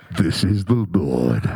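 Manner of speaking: deep, slow booming God voice. Acted. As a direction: play God, low and unhurried, mock surprised. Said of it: "That's how I think God sounds".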